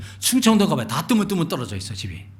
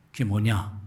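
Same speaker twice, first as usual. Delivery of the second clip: usual speaking voice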